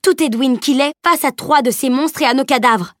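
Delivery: indignant outburst. Acted. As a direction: cut him off and defend the girl. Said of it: "Elana lui coupa la parole".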